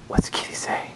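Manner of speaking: put-on soft whispered question to a baby. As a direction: whisper it like a secret. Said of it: "whisper to toddler".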